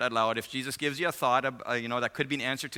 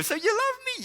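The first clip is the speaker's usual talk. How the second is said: imitated hopeful woman. Fiancée checking he forgives her, high hopeful imitation. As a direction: squeak the question as her.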